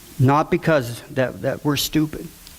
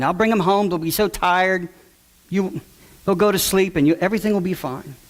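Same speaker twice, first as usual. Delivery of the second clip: higher-pitched, coaxing retold voice; imitated persuasion; 5 semitones higher than usual. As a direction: imitate someone talking a friend round. Retold John persuading the parent.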